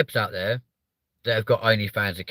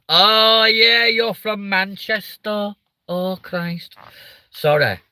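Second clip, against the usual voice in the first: bad Manchester accent; 3 semitones higher, 4 dB louder than usual. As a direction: put on a mock Mancunian voice. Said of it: "impressions of doing that one".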